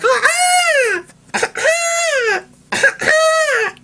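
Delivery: very high prehistoric shriek; pterodactyl screech. Retold or imitated. Kid imitating a swooping pterodactyl, high screech. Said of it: "They're like".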